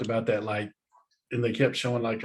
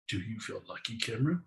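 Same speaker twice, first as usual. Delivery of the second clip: Clint Eastwood; 3 semitones lower, 8 dB quieter than usual. Pretend. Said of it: "It's gonna be my new thing now".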